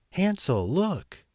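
put-on high, excited little girl's call; excited cry. A narrator's reading. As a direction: call out eagerly to her brother. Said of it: "cried Gretel".

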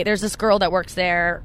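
ordinary talk

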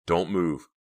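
loud, hard commands; menacing guard's warning; stern warning. A narrator's reading. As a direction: warn them, each order punched. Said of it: "he warned".

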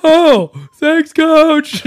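high, loud mock gratitude; hammed-up imitation of a relieved athlete; relieved dopey player. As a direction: shout thanks as the suddenly cured player. Player voiced, suddenly cured.

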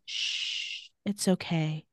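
soft, low retold reassurance; soothing hush. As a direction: hush gently, as if calming the dying. Matt soothing dying Nadia, retold.

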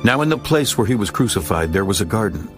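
ordinary talk, nothing marked

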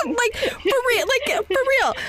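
high, laughing through the words; laughing agreement. Real, not acted. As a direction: squeal it out while still laughing.

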